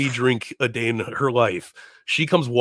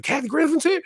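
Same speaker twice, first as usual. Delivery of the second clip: very high, thrilled question; excited. Retold or imitated. Chappelle hearing another comedian is there, imitated excitement. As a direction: squeal it in delighted disbelief. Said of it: "he was so excited".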